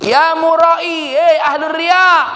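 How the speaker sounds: high, slow, loud mocking shout; calling out insult. Old woman shouting at Imam Hasan Al-Basri, mocking. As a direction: yell it across at him.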